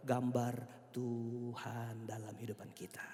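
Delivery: hushed, breathy answer to his own question; whispered answer. Acted. Preacher whispering the answer to the congregation. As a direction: drop to a whisper for the key line.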